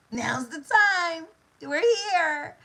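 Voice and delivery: imitating a mom beaming that it's time; thrilled mother-to-be. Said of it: "plethora of excitement in her voice".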